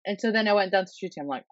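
normal talking voice

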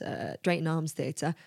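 normal talking voice

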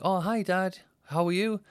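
put-on high, chirpy little kid greeting Dad; small child. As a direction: pitch it well up like an innocent child.

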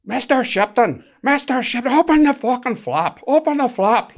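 high, loud Northern Irish prisoner shouting; Northern Irish accent. Retold or imitated. Naked prisoner in Northern Irish accent shouts at the officer to open up. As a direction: bang on the door in a Northern Irish accent, demanding. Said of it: "I'm not bad at your Northern Irish accent".

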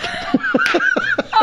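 high-pitched burst of laughter; big laugh. Real, not acted. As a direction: crack up at the story's end. Laughs after punchline.

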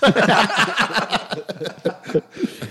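loud, high burst of laughter; big laugh. Real, not acted. Listener cracks up at friend's flare story, big laugh. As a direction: laugh long and loud at the punchline.

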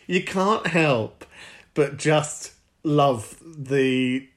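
usual speaking voice

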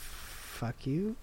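very quiet, half-hearted curse; soft curse, barely committed to it; uncommitted. Real, not acted. Cursing at the co-host under the breath, uncommitted. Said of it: "as if you weren't really committed".

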